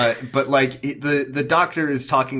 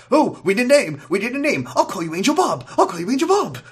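higher, hurried improvising Doctor; mock Matt Smith doctor; 10 semitones higher than usual. Mocking Matt Smith's Doctor naming the angel, much higher and hurried. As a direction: blurt it like a man inventing a name on the spot. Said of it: "Matt Smith was like".

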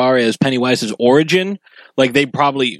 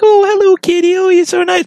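put-on much higher and louder baby talk; high-pitched pet talk; 6 dB louder than usual. Shown as a demonstration. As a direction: coo way up high at the kitty. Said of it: "talking to your dog or your cat in a high-pitched voice".

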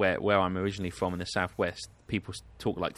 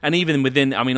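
usual speaking voice

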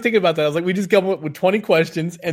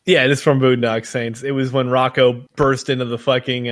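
ordinary talk

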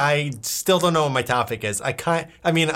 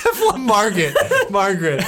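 laughing; 4 semitones higher than usual. Real, not acted. Amused repeat of silly name.

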